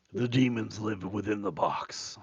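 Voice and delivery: lightly voiced ominous demon; mock demon. Joking about voices coming from the box, mock-ominous. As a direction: intone it like a hint of something possessed.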